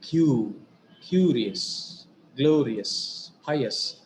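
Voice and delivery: firm first beat on "Q", "curious", "glorious", "pious", then falling; penultimate stress. Shown as a demonstration. Teacher showing the stress rule, first syllables stressed in "curious", "glorious", "pious". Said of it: "we have given the stress on".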